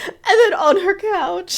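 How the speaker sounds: squeaky laugh-cry through the words; can barely get it out for laughing. Real, not acted. Cohost reacting to the couch detail, laughing helplessly. Cracking up at the shoes.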